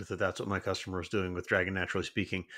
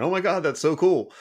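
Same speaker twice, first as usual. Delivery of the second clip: high, loud amazed gush; excited; 8 semitones higher, 6 dB louder than usual. Retold or imitated. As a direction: gush like it's brand new. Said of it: "and I went".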